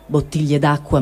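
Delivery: plain reading voice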